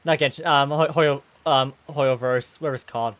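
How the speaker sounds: ordinary talk, nothing marked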